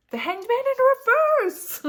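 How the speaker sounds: high, loud thrilled cry; excited shout. Real, not acted. Reader yelling at viewers as the same card returns, thrilled shout. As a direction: shout it out in disbelief.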